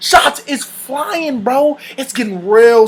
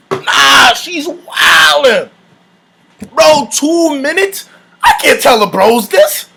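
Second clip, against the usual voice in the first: slow, higher, wailing protest; mock anguished disbelief; 3 semitones higher, slower than usual. Dreading telling the bros, mock anguish. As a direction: wail it out in stretched disbelief. Acted dismay at two minutes.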